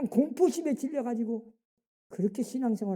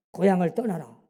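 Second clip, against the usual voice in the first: God's command; 5 semitones lower, 4 dB louder than usual. Acted. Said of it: "그러고는 말씀하세요".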